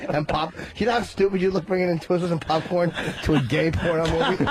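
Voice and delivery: ordinary talk, nothing marked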